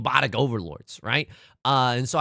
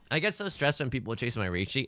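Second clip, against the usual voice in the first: usual speaking voice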